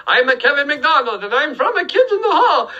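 put-on high-pitched, hammy Italian accent; cartoonishly high mock-Italian introduction; bad Italian accent. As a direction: do a badly faked Italian accent. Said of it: "my bad Italian accent".

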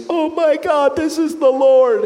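imitating a waitress overwhelmed; losing it. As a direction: cry it out in awe. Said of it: "she just lost it".

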